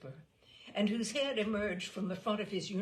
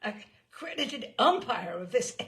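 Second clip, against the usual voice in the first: declaring; 4 semitones higher, 8 dB louder than usual. Acted. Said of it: "the lieutenant declared".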